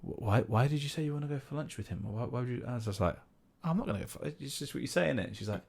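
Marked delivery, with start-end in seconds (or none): 0.0-2.6 puzzled partner. Retold or imitated. Partner's puzzled question acted out. 3.7-5.3 high, soft protest; light, raised-pitch mock explaining; dismissive reply.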